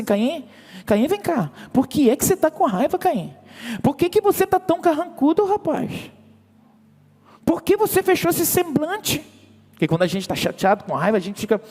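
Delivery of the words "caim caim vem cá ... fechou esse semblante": much higher, pleading voice of God; God calling Cain. Acted. God asking angry Cain why his face fell, high and pleading. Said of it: "Deus vê as nossas ações e diz assim".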